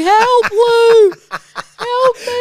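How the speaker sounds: high, loud pleading cry; hammed-up call for rescue; mock cry for help. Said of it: "you're on the ground going".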